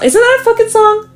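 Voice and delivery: suddenly tickled by her own rhyme; a high, excited squeal of discovery; amused surprise. Real, not acted. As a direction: pitch jumps way up, delighted. Suddenly noticing her words rhyme.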